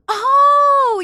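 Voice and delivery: high, loud sudden realization; surprised oh. Real, not acted. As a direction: gasp out a surprised oh. Realizes the name is Red Ace.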